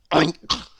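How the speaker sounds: short snorted oink; pig oink. Acted. Pig with bucket on her head, oinking. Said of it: "oinked Polly the pig".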